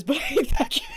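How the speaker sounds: venting through real laughter; amused grumble dissolving into giggles; laughing. Real, not acted. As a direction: break into laughter as you complain. Laughs while venting about kid.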